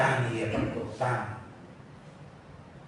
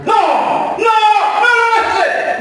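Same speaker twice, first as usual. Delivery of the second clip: high, very loud mock shout of no; hammed-up shouting voice; 15 dB louder, much slower than usual. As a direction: yell it like a sudden voice from nowhere. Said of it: "yo escucho una voz".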